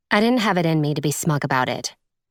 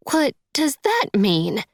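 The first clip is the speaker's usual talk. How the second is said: high, slow puzzled woman's voice; puzzled, pitched well up; baffled stepmother; 9 semitones higher than usual. A narrator's reading. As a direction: ask it high and drawn out, bewildered. Tearful stepmother, baffled by narrator's sympathy.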